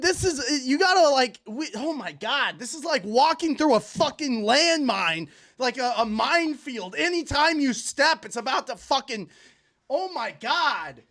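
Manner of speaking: ordinary talk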